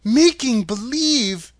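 stretched, high-pitched disbelief; incredulous cry. A narrator's reading. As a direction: cry it out as an astonished girl. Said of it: "cried Dorothy".

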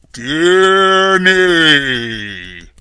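very loud, slow, drawn-out boom; booming wizard Oz. A narrator's reading. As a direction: thunder it out like a mighty wizard. Booming Oz voiced in story.

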